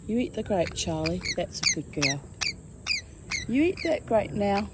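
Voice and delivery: usual speaking voice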